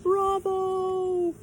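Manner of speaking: high, loud cheer; shouted bravo. A narrator's reading. Children cheering Pippi's coffee idea, loud high bravo. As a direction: cheer it out with glee. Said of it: "clapped their hands and shouted".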